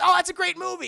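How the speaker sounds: high, loud burst of enthusiasm; mock excited exclamation. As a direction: imitate a hero cheering in passing. Hero's passing remark, acted.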